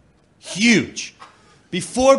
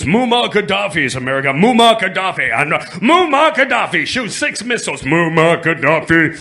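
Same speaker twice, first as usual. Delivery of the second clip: mock newscaster; 5 dB louder than usual. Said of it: "next on the news".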